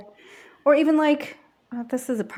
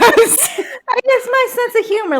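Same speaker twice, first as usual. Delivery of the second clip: high, laughing, slow; 10 dB louder, slower than usual. Real, not acted. To her friends, laughing about her humor. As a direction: squeal it through laughter.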